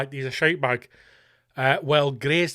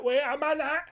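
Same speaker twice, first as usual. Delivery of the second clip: wait a minute jumps much higher; rising disbelief; 11 semitones higher than usual. Shown as a demonstration. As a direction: pitch the second one up in disbelief.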